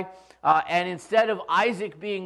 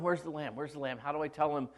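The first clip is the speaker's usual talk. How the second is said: muttering to himself; 4 semitones lower, 11 dB quieter than usual. Retold or imitated. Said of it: "here's Abraham going".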